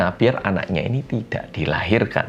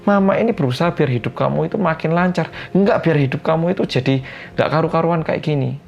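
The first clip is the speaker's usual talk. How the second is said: angry mother; 8 semitones higher than usual. Retold or imitated. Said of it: "Mamanya marah balik".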